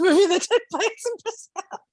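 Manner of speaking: talking through laughter, voice climbing high; helpless high giggle under the words; amused laughing. Real, not acted. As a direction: crack up while finishing the sentence. Laughing about a film set there.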